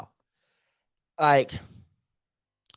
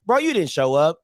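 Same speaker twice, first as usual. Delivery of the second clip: normal talking voice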